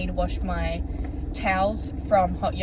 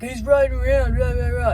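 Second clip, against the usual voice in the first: louder, higher mock rant; mocking rambling man; 4 semitones higher, 6 dB louder than usual. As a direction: imitate his ranting, trailing into nonsense syllables. Mocks the man's rambling at boy.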